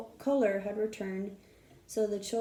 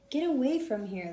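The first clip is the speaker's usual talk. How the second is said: spoken through clenched teeth; gritted teeth; 4 semitones higher, 5 dB louder than usual. Acted. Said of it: "said through gritted teeth".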